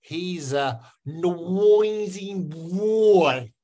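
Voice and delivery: exaggerated Myanmar accent with a w glide in "noisy boy". Shown as a demonstration. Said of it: "do it in a Myanmar accent".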